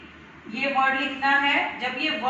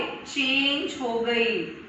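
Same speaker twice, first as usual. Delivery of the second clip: slow emphatic answer; 3 semitones lower, much slower than usual. Shown as a demonstration. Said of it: "यहाँ पर आके sound क्या हो गई?".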